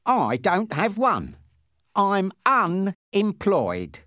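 slow, high, drawn-out reply; deliberate answer. A narrator's reading. Passenger answering the policeman's job question, slow and deliberate. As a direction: stretch out each word.

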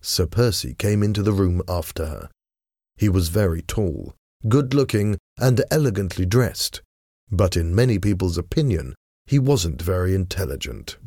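ordinary delivery, nothing marked

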